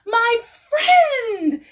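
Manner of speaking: high, loud joyful cry; gleeful exclaim. A narrator's reading. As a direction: shout with delight at reunion. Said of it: "exclaimed with glee".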